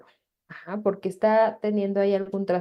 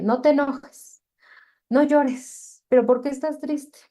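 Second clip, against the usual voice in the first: scolding parent; 6 semitones higher than usual. Retold or imitated. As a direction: play the dismissive parent brushing feelings off. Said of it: "culturalmente es como de".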